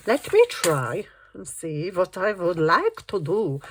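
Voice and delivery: slow, lightly Russian "let me try"; a hint of a Russian accent, slowly; mock Russian accent. Real, not acted. Trying out pages, slipping into a hint of Russian accent. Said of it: "I've just gone, um, Russian".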